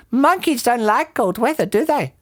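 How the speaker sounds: high-pitched, knowing bird; roly-poly bird. A narrator's reading. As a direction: advise in a high creature voice. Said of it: "the roly-poly bird said".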